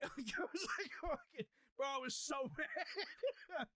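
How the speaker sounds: high-pitched, breaking into laughter; real laughing. Real, not acted. Telling friends how mad he was, laughing helplessly. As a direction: laugh through the words, stretching the last ones.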